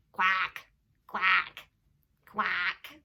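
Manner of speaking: low loud quacks; duck quacking. Shown as a demonstration. Imitating duck.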